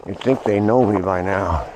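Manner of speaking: ordinary talk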